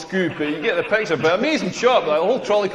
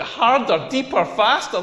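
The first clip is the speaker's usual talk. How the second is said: mock-appalled repeat, pitched up; incredulous echo; 3 semitones higher than usual. Comedian reacting to demands in bed, incredulous. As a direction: throw her words back in disbelief.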